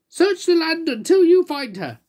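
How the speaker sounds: very high, loud insistent cry. Acted. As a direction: demand the winner be found. Cried Little Miss Splendid.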